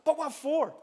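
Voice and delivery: lightly acted boy wanting a reason; baffled question. A narrator's reading. Boy asking the lion why, baffled.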